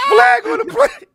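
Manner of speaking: hammed-up referee shout; mock referee yell. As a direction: shout the call back, high and loud. Cohost echoes mock call.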